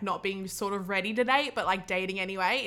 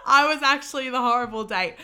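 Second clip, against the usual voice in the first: laughing hard through the words; giggling at her own story, louder; 5 semitones higher, 4 dB louder than usual. Real, not acted. Laughing as she confesses to listeners she was the bad date.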